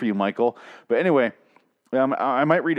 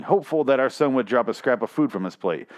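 ordinary talk

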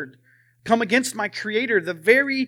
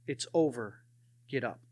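quiet divine voice; 4 semitones lower, 5 dB quieter than usual. Retold or imitated. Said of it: "and a voice said".

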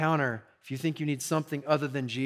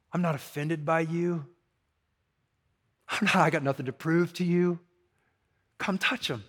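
gentle reassurance. Retold or imitated. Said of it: "Shows up in the room and goes".

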